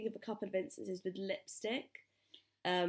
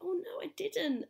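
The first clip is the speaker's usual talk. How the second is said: nervous fib; 11 semitones higher than usual. Retold or imitated. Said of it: "I just kind of went".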